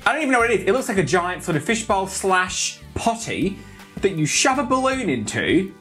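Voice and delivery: ordinary talk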